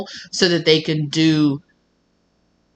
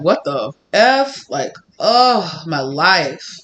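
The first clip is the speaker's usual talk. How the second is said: exasperated; 4 dB louder, much slower than usual. Retold or imitated. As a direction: groan it out slowly in mock despair. Voicing the person's exasperated reaction.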